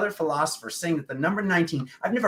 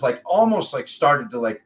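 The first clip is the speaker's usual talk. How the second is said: normal talking voice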